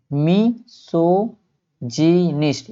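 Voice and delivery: मिसोजिनिस्ट very slowly, mi - so - ji - nist; syllable-by-syllable. Shown as a demonstration. Teacher shows how it is read, मिसोजिनिस्ट very slowly by syllable. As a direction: draw out every syllable of मिसोजिनिस्ट separately. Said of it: "क्या पढ़ेंगे इसको?".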